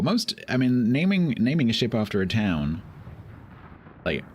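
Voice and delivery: usual speaking voice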